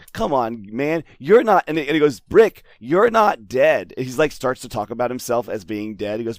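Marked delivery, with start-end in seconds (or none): 0.1-1.6 exasperated. Retold or imitated. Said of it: "it's totally just like". 2.2-3.9 loud, slow mock pleading; higher, drawn-out imitation of patient explaining; plaintive. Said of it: "plaintively trying to explain".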